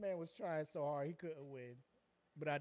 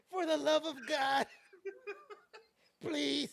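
high, loud, halting plea; hammed-up cry for mercy in pieces; mock begging for mercy; 9 semitones higher, 5 dB louder, much slower than usual. Beaten man begs his attacker, mock desperate.

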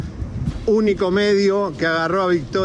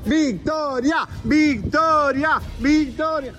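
high, drawn-out chant of a name; calling out Victoria; 8 semitones higher, much slower than usual. Shown as a demonstration. Reporter shows fans how to yell, calling out. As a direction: lead the crowd in a slow, high cheer. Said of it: "quiero que griten Victoria".

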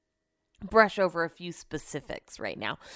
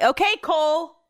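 mimicking Cole; 8 semitones higher, 6 dB louder than usual. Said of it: "you're Cole from Love Is Blind".

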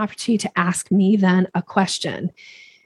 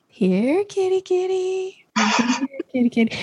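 higher, coaxing here kitty kitty; soft cat call; 11 semitones higher, slower than usual. Shown as a demonstration. Speaker demonstrating how you call a cat, soft and coaxing. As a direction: coax a cat gently. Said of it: "a little bit of a softer approach".

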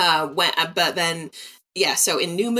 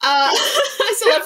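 laughing; 5 dB louder, slower than usual. Real, not acted. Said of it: "residual affection".

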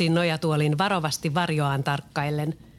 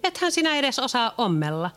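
higher, teasing retort; teasing girl; 7 semitones higher than usual. Acted. Leena teases Peter about sewing, higher teasing voice. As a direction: tease him he can't sew. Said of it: "Leena tuhahti".

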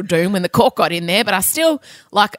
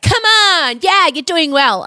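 cheesy fitness coach; 7 semitones higher, slower than usual. Retold or imitated. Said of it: "whenever I watch other people like".

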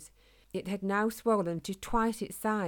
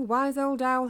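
put-on loud, higher repeated call leaning on old; shouting again; 6 semitones higher, 7 dB louder than usual. A narrator's reading. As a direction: call once more into the dark. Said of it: "he shouted".

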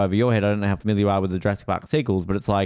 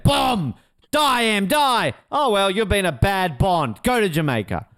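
mock villain ranting; 9 semitones higher, slower than usual. Mocking the villain's plot.

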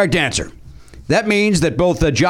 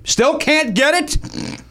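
slow, higher teasing drawl; drawn-out dramatic tease; 10 semitones higher, much slower than usual. Acted. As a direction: stretch it out like a game show host. Host drawls game rule dramatically.

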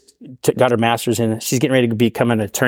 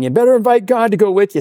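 high, loud call from afar; background shout; 10 semitones higher, 4 dB louder than usual. Retold or imitated. Husband calling out in the background, high and loud. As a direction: yell it from the back of the room.

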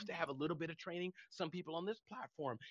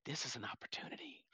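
a secret aside, breathy and unvoiced; whispered thought; 4 semitones lower, 11 dB quieter than usual. Telling the story, he whispers his private thought. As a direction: whisper it to yourself.

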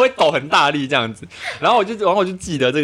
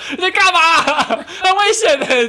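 much higher, louder, laughing scolding; imitating furious mom; 4 dB louder, much slower than usual. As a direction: mimic mom yelling while driving. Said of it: "就大发飙".

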